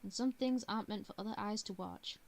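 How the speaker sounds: ordinary delivery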